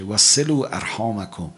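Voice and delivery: slow, measured hadith chant; solemn recitation; Arabic recitation. Acted. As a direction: intone the Arabic slowly.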